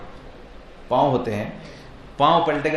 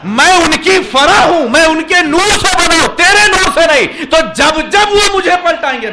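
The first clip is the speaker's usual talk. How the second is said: high, very loud sermon delivery; impassioned high-pitched shouted sermon; full-force preaching; 13 semitones higher, 13 dB louder than usual. Acted. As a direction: preach it at the top of your voice.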